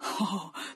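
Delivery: short low chuckle; a quick low laugh to a friend. Acted. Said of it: "八戒笑着对沙僧说".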